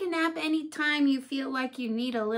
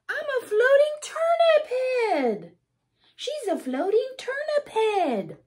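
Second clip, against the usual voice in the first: much higher and louder; giddy playful chant; silly excited; 13 semitones higher, 6 dB louder than usual. Acted. Reader laughing about the floating turnip head, high and excited.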